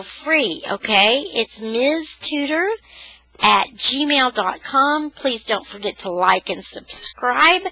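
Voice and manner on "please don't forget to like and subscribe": ordinary delivery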